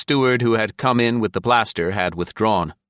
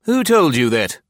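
sharp, higher and louder demand; sharp question; 7 semitones higher, 5 dB louder than usual. A narrator's reading. Commissioner questioning a young officer about a rumour, sharply. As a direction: fire the question sharply, falling at the end. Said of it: "the commissioner asked sharply".

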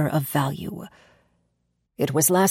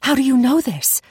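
much higher, louder startled question; shocked gasp; 8 semitones higher, 4 dB louder than usual. A narrator's reading. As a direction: gasp it out in alarm. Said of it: "I gasped".

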